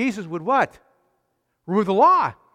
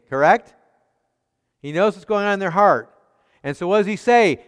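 ordinary talk, nothing marked

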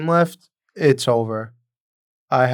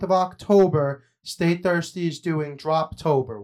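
higher-pitched, upbeat sponsor read; 5 semitones higher than usual. Acted. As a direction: pitch it to listeners like a podcast promo. Reads a sponsor ad.

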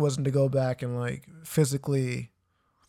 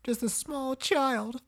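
high, slow, small voice; mock little child; 10 semitones higher, 4 dB quieter, slower than usual. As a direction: echo the baby bit in a tiny drawn-out voice. Joins in the mocking baby bit.

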